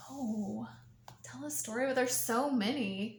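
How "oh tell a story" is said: soft whisper while thinking; whispered to herself. Real, not acted. Guest stalling on the story prompt, whispering to herself. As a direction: breathes the words to herself, stalling for time.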